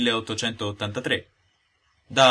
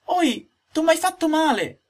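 very high, slow pained little cry; the log's tiny voice crying in hurt; 5 dB louder, slower than usual. Acted. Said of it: "gridò rammaricandosi la solita vocina". (vocina acutissima che grida di dolore)

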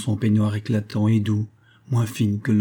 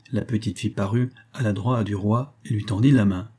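usual speaking voice

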